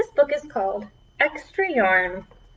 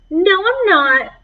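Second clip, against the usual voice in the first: put-on higher, louder childish denial; defiant kid; 8 semitones higher, 7 dB louder, slower than usual. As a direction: snap back like a stubborn boy. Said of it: "said Nate".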